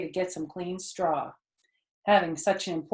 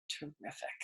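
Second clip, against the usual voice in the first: hushed; 4 semitones lower, 17 dB quieter than usual. A narrator's reading. Word on the web revealed.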